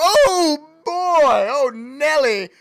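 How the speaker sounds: loud, high thrilled exclamation; big play-by-play whoop of joy; excited call. Real, not acted. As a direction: shout it out as the runner breaks free.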